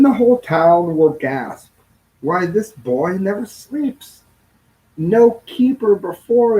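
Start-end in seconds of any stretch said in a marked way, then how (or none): none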